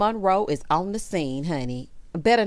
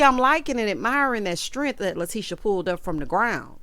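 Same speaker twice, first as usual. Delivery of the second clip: normal talking voice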